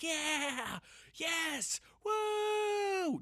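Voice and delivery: high, climbing whoops of joy; excited kid shouts. Retold or imitated. To his wife, imitating kids whooping in high shouts. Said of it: "just like".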